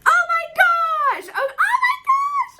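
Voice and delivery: very high, loud alarmed oh my gosh; panicked at something dropped; startled cry. Real, not acted. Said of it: "Oh, I dropped something".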